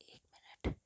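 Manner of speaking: whispered एक minute off-mic; a quick private whisper; whispered aside. Real, not acted. Aside while pausing lesson. (फुसफुसाकर)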